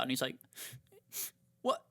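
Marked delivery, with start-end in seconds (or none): 1.6-1.8 high, put-on affronted chef; mock offended chef. A cook mimicking Gordon Ramsay, offended and put out. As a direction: play a chef taken aback by criticism.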